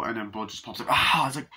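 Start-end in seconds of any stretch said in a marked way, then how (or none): 0.9-1.3 short loud mock "ah"; quick raised cry of fright; mock startled cry. Retelling Bud popping up on the nurse, mock startle.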